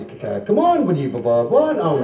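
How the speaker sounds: high-pitched comic stutter; mock stammer, jumping up high; stammering boss. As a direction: stumble repeatedly on the opening consonants.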